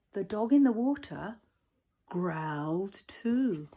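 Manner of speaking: ordinary delivery, nothing marked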